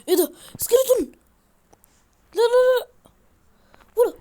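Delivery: high, loud panicked shriek at the skeleton; panicked scream. Real, not acted. Gamer spotting a skeleton attacking him, shrieking in panic.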